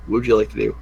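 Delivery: ordinary talk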